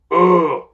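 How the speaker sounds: loud imitated tired grunt; mock grunt. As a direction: grunt it out like someone exhausted. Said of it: "I grunted at you".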